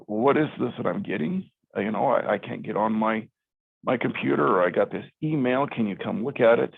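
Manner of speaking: normal talking voice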